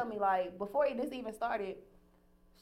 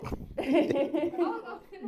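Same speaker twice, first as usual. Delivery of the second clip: real laugh; 8 semitones higher, 4 dB louder than usual. Real, not acted. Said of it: "You seen that?".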